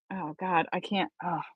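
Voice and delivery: soft exasperated groan; exasperated mutter. Real, not acted. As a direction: give up under your breath. Reader exasperated after flubs.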